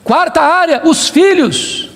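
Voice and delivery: usual speaking voice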